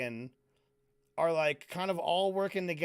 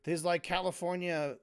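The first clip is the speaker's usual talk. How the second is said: ordinary talk, nothing marked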